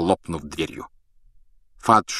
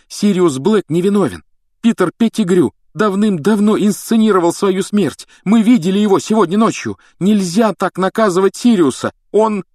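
high, loud, urgent outburst; agitated; 12 semitones higher, 7 dB louder than usual. A narrator's reading. Harry insisting to the minister that Sirius is innocent, agitated. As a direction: blurt it out excitedly. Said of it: "взволнованно заговорил Гарри".

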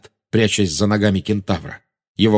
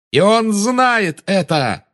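high, drawn-out taunt; defiant cry; 12 semitones higher, 4 dB louder, much slower than usual. A narrator's reading. Boy taunts the witch about her master, crying out. Hero defies witch in duel.

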